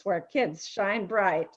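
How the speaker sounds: usual speaking voice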